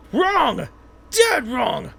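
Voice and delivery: coach's shout. Acted. Coach berates idol hopeful.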